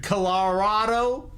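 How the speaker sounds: Colorado stretched out slowly with a rising questioning end; deliberate mispronunciation. Shown as a demonstration. Streamer butchering state names for chat, deliberate mispronunciation. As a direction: draw out "Colorado" and lift the last syllable. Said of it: "pronounce all the names wrong".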